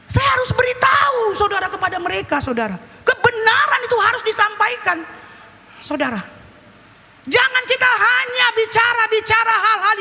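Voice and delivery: high-pitched, insistent sermon delivery; forceful preaching. Acted. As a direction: press the duty on the congregation urgently. Preaches to the congregation. (khotbah tegas bernada tinggi)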